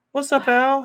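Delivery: high-pitched pretend buddy voice; squeaky silly voice. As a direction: answer as a goofy little character. Answers himself in squeaky voice.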